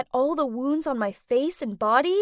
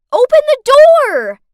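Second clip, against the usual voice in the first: high, loud scream at the door; 12 semitones higher, 16 dB louder than usual. Acted. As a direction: scream to be let out. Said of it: "banged on the door and screamed out".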